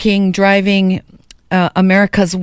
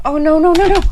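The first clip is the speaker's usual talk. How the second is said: flustered surprise; 8 semitones higher, faster than usual. Real, not acted. Startled by the station disclaimer.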